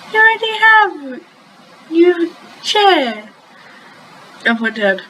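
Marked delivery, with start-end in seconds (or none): 0.1-3.3 teasing. Acted. Character teasing triumphantly.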